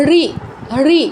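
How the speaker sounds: রি short, tongue curled back; retroflex short ri. Shown as a demonstration. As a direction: curl the tongue behind the gum ridge for a brief রি. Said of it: "এটার উচ্চারণ হচ্ছে মূর্ধায়". (জিভ উল্টে ছোট মূর্ধন্য রি)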